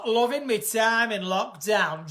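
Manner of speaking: slow, broader Northern "Loving me time in lockdown"; Northern accent. Shown as a demonstration. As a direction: stretch it in a Yorkshire accent. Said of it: "Are you from North?".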